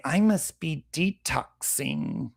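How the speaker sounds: sarcastic slow imitation of someone self-diagnosing; mock whiny quote. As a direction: drag it out like a clueless wellness fan. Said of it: "and they say".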